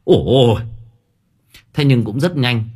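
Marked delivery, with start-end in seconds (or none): 0.0-0.6 startled, panicky exclamations; horrified cry. A narrator's reading. Servant runs in from the stable, crying out in horror. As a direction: cry out in short, alarmed bursts. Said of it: "nó hét lên thất kinh".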